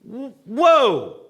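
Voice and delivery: sudden high exclamation of alarm; surprised whoa. Real, not acted. Pastor to congregation, a loud startled whoa. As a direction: react to the warning like it's shocking.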